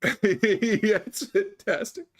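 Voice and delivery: high, squeaky words through laughter; helplessly cracking up while talking; real laughter. Real, not acted. Cracking up at the retold story.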